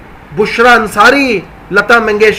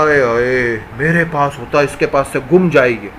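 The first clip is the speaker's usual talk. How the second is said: envious mutter; 8 semitones lower, 5 dB quieter than usual. Acted. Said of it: "کسی کے پاس mobile اچھا دیکھا".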